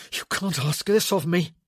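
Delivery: breathy, gasping protest; gasped. A narrator's reading. Man pleading with the woman holding him to it, gasping. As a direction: gasp it out under strain. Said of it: "he gasped".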